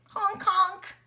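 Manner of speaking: playful goose call; goose honk. Retold or imitated. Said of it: "What does a goose say".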